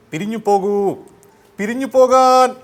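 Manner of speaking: Jayan. Acted. Hero ordering the crowd to disperse, building film shout. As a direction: bellow the command, stretching the last word. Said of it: "അങ്ങാടിയിലെ ശബ്ദം ചെയ്യാം".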